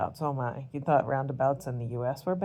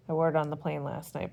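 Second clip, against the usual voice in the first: ordinary talk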